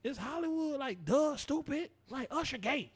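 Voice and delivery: high sarcastic mock voice. Mocking the mother's [name] jab. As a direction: scoff at it in a high put-on voice.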